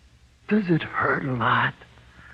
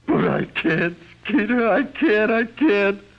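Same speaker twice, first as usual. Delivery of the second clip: high, louder, panicked begging; desperate pleading; 10 semitones higher, 4 dB louder than usual. Acted. Condemned man begs Skeeter, high and panicked. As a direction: voice climbing in terror.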